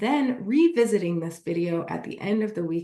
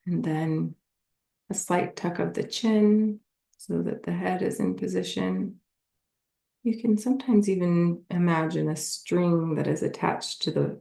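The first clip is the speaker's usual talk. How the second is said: guided meditation; 4 semitones lower than usual. Acted. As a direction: lead them gently through a body scan, unhurried.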